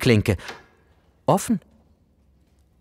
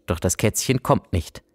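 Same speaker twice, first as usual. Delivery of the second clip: ordinary delivery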